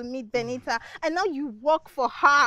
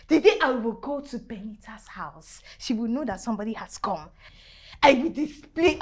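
normal talking voice

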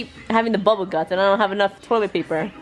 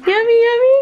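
sing-song coo; 13 semitones higher, 6 dB louder than usual. Real, not acted. Feeding baby, louder coo.

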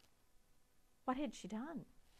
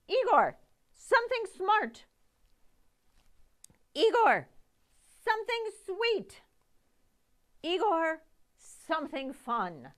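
loud, high, eager calls to Igor; calling out orders; 8 semitones higher, 12 dB louder than usual. Acted. Victoria calling ingredients to Igor, loud and eager. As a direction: shout each order up to the assistant.

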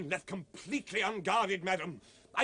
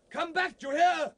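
loud, high shout after her; urgent distant calling; 9 semitones higher, 3 dB louder than usual. Acted. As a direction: call her back across the marsh. Man calling after fleeing woman.

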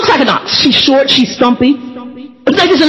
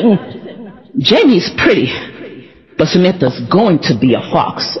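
prophesying grandmother; 6 semitones lower, 3 dB quieter, slower than usual. Retold or imitated. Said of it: "Grandma prophetically spoke over".

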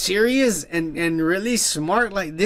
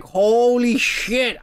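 stunned exclamation; 5 semitones higher, much slower than usual. Retold or imitated. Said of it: "And I'm like".

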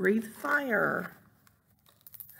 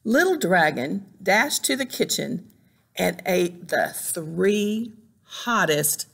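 ordinary delivery, nothing marked

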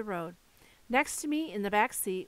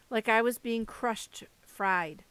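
ordinary delivery

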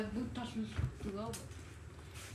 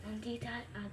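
ordinary delivery

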